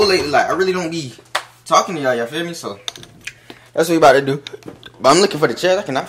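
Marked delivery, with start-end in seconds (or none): none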